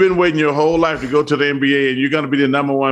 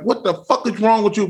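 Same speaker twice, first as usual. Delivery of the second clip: retold yell; 6 semitones higher than usual. Retold shaking the drink thrower.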